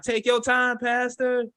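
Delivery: high-pitched mock congregation call; churchgoer in the background. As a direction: egg the pastor on from the pews. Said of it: "Devin was the dude at church in the background".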